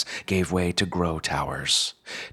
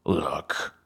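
lightly voiced advisor dropping into an anchorman tone; a touch of newsreader gravity; anchorman's low register. A narrator's reading. Advisor leaning across his desk to persuade a student, anchorman's register. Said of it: "The advisor dropped an anchorman's octave".

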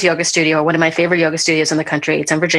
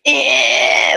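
body's strained grunt; 5 semitones higher than usual. Retold or imitated. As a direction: grunt like the body giving up. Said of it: "And my body was like".

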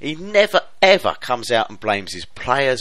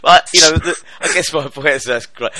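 cracking up mid-line; 5 semitones higher, 7 dB louder than usual. Real, not acted. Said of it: "I'm laughing at my own joke".

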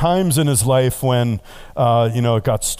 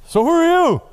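high, loud squeaky question; cartoon fisherman; 3 dB louder than usual. VeggieTale fisherman asking the stranger on the sand, squeaky. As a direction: ask it like a startled cartoon fish-man.

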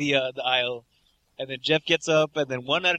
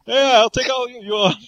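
high, loud hollow boast; false bravado shout; 8 semitones higher, 8 dB louder than usual. Acted. Mocking a cornered bluffer, loud hollow bravado shout. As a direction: puff up to hide the panic.

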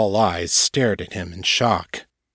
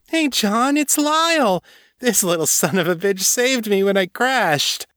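high-pitched tipsy delight; happy cry; 13 semitones higher than usual. A narrator's reading. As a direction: gush excitedly to a friend. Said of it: "she cried happily".

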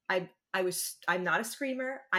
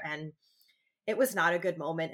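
normal talking voice

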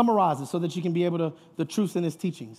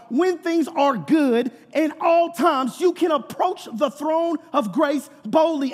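raised, driving sermon voice; preaching; 9 semitones higher, 6 dB louder than usual. Real, not acted. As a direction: preach it with force and conviction. Preaches at full force.

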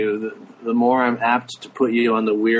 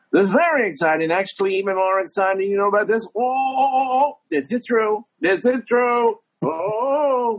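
high-pitched late-night host impression; Jay Leno; 11 semitones higher than usual. Comedian doing a radio walk-on, high Leno impression. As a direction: push the voice way up, excited and repetitive. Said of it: "I was doing Leno like".

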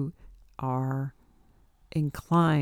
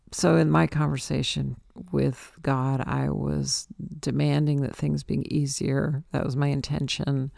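ordinary talk, nothing marked

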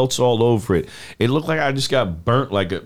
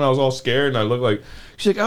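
ordinary talk

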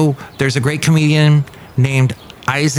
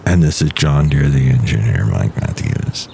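much lower, slow put-on voice; deep character voice; 10 semitones lower, slower than usual. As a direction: sink into a low dopey character. Deep character John Deere speaks.

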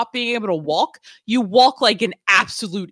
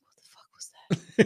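whisper; 4 dB quieter than usual. Retold or imitated.

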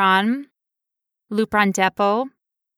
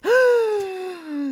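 dramatic gasp; 11 semitones higher than usual. Pretend. Mock shock at teaser.

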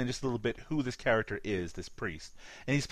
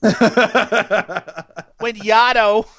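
put-on loud, drawn-out, higher Boston accent; mock Boston accent; 7 semitones higher, 12 dB louder, much slower than usual. Jokey Boston accent for his co-host. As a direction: stretch it out like a Boston tough guy. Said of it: "A Canadian doing a Boston accent".

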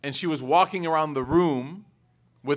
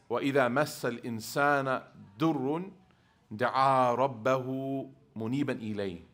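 Quran recitation; 2 semitones lower, 4 dB quieter, much faster than usual. Acted.

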